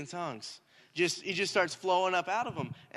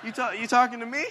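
laughing disbelief; 6 semitones higher, 6 dB louder than usual. Retold or imitated. Kid stunned at being picked.

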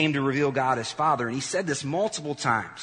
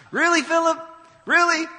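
incredulous; 10 dB louder than usual. Real, not acted. Mocking disbelief at Philip.

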